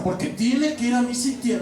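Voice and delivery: high-pitched parody of nagging; put-on high, dutiful scolding; mock insistence. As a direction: imitate someone insisting on a duty. Parodies obligation-minded insistence on Mass.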